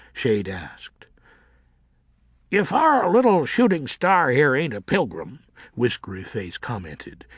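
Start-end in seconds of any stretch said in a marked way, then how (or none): 2.5-5.4 mocking bat. A narrator's reading. Whiskery Face mocks the newcomer.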